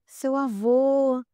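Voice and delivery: very slow, dreamy voice of the sedated mother; entranced drugged mother. Retold or imitated. Sedated mother telling daughter she sees grandfather, slow entranced voice. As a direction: stretch it out as if in a trance. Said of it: "ela olhou assim pra mim".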